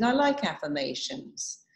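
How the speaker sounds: ordinary delivery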